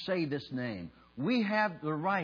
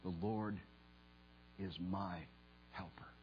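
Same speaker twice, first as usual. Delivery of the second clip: soft, low, with long reverent pauses; hushed, intimate preaching; 8 semitones lower, 13 dB quieter than usual. Real, not acted. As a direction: bring it down low and let each phrase settle. Hushed personal appeal to congregation.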